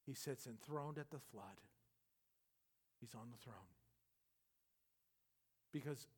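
very soft, low, with long pauses; hushed reassurance. Real, not acted. Preacher telling listeners God is on the throne, hushed. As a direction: bring it right down, low and unhurried. Calming the congregation after the shouting.

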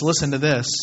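normal talking voice

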